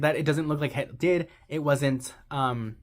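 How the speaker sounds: ordinary talk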